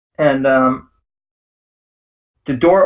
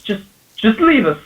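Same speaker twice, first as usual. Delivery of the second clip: nervous father. Acted. GM voices nervous father behind door.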